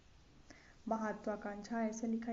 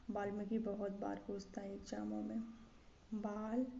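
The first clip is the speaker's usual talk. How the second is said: ordinary delivery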